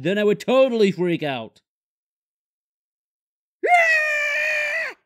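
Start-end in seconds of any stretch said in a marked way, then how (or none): 3.6-5.0 sudden high scream of horror; shrill scream of panic. Acted. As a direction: shriek as if the phone is gone. Discovers phone is missing.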